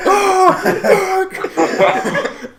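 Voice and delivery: very high shrieking yelp through laughter; mock pained yelp. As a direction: squeal it like a sudden hit. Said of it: "And you're like".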